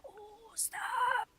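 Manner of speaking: high whispered mock cry; mock pleading whisper. Mimicking her laughing friend, a whispered mock plea. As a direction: beg under your breath in a fake sob. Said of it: "and I was like".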